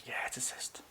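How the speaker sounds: hushed, whispery bad news; sad vet. Vet breaking news about a pet budgie, sad whisper. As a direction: imitate a sorrowful vet, barely voiced. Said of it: "he was being really, like, sad about it".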